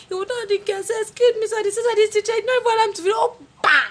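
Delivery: aggrieved high wail; high, loud whiny complaint. Retold or imitated. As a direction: plead your innocence loudly. Recounting to father, whining.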